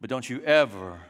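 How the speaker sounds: a stern dad coolly shutting out his returning son; cold rejecting father. Pretend. Older brother imagines dad rejecting the prodigal, icy and quiet. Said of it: "he wanted Dad to say".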